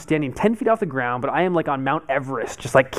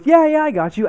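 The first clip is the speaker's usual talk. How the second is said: a much higher, louder caricature of his friend's breezy reassurance; mock reassurance; 6 dB louder than usual. Friend on the ground brushing off his fears, high mocking voice. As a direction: mimic the careless buddy. Imitating Jerry reassuring him.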